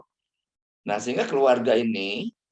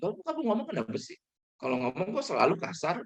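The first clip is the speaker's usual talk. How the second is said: wife complaining; 6 semitones higher, 4 dB quieter than usual. Acted. Wife scolding husband, acted quote.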